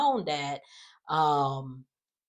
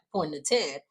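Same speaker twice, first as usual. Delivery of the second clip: ordinary talk, nothing marked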